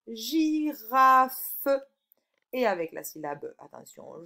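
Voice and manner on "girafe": loud, separated syllables; sounded out. Shown as a demonstration. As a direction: break the word into beats for the pupil. Teacher sounding out the answer word. (mot découpé en syllabes appuyées)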